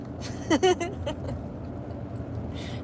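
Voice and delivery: breathy unvoiced chuckle; a quiet laugh at herself; amused little laugh; soft laugh. Real, not acted. Laughs at own situation.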